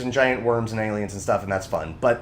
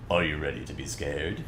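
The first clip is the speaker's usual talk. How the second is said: very low, soft spooky host voice; spooky announcer; 11 semitones lower, 8 dB quieter than usual. Acted. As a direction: creep them out with a deep hush. Spooky Halloween intro.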